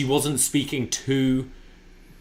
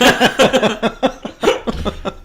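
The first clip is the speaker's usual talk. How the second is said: loud high laugh; 11 semitones higher, 8 dB louder than usual. Real, not acted. Big laugh.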